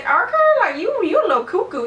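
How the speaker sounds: high, swooping mock-polite voice; mocking disbelief. Woman retelling her reply to a pushy date, high mocking disbelief. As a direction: sing it out high, humouring a weirdo.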